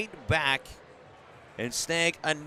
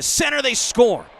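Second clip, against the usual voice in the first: excited goal call; 10 semitones higher, 9 dB louder than usual. Real, not acted. Goal call in hockey broadcast.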